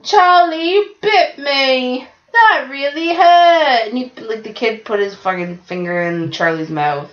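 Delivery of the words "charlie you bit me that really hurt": put-on much higher, slow whiny little boy; Charlie bit me kid. Quoting the viral video to a friend, slow high whiny kid voice. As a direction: whine slowly like a hurt little brother.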